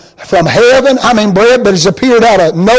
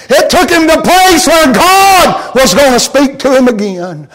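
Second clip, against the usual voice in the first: rising preaching; 6 semitones higher than usual. Acted. Preacher building to a climax.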